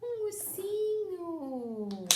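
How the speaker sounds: put-on drawn-out sing-song to a baby, high and slow on ursinho; baby talk. Shown as a demonstration. A mother showing her baby a teddy bear, high slow baby talk. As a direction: coo it slowly like showing a toy. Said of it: "Você fala".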